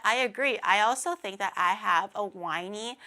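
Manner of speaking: normal talking voice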